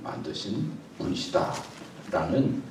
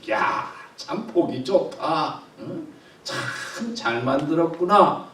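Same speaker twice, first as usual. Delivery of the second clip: higher, slow and beaming; delighted, near tears of joy; 6 semitones higher, slower than usual. Shown as a demonstration. God admiring creation, slow and overjoyed. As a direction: savor each word like a proud maker. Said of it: "막 기분이 좋아가지고".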